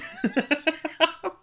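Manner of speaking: squealing laugh; high giggle. Real, not acted. Laughing mid quote.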